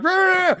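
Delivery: high-pitched imitated rage yell; mock raging roar. Fan imitating YouTuber raging at hot pepper, high yell.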